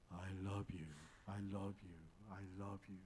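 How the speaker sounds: soft, low distant echo. Retold or imitated. Echo answering the girl from far away, soft and low. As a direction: let it fade like an echo. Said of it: "다시 또 소리가 들려오는데".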